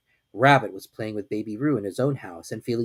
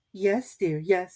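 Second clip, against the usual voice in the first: soothing; 8 semitones higher, much slower than usual. A narrator's reading. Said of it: "said Kanga soothingly".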